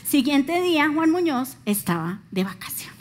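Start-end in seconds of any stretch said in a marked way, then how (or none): 1.6-2.9 whisper. Real, not acted. Punchline aside.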